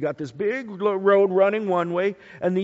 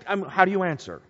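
ordinary delivery

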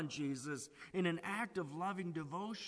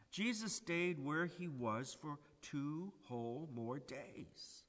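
ordinary talk, nothing marked

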